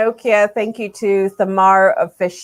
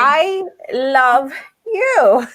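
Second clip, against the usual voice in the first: very drawn-out, higher "I love you"; emphatic affection; 7 semitones higher, much slower than usual. Real, not acted. Host answers her friend's praise, slow gushing affection. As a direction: stretch every word out, full of love. Heartfelt repeat to admiring friend.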